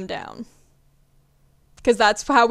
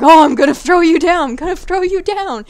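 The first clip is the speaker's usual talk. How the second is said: higher, louder teasing threat; mock taunting catcher; 6 semitones higher, 7 dB louder than usual. Catcher taunting the runner on second, higher, louder mock threat. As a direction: taunt the runner with a fake throw. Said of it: "doing this whole fake feint of".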